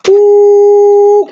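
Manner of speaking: loud, high, sustained hoot; steady high-pitched "ooh"; long high ooh. Real, not acted. Reacting while calling on spirits.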